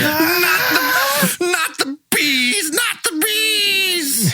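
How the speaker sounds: very high, drawn-out panicked wail; hammed-up frantic cry, each word stretched; Nicolas Cage. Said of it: "Just gets really mad".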